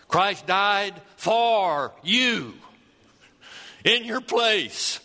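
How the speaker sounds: normal talking voice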